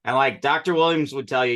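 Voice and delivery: normal talking voice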